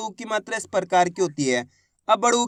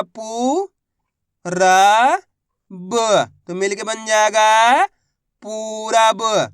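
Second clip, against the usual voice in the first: syllables spaced out, then a very slow, loud पूरा ब; धीरे धीरे अक्षर जोड़कर पढ़ाना; slow syllable blending; 4 dB louder, much slower than usual. Shown as a demonstration. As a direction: teach it letter by letter, stretching the final word. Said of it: "मैं किस तरीके से एक अक्षर का उच्चारण करके".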